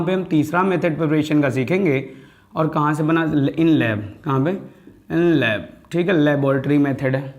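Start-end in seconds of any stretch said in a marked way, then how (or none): none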